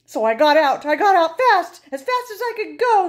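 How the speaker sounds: high, loud, hurried escape; panicked rush. Acted. Narrator fleeing the spooky pants, loud and panicked. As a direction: bolt away in alarm.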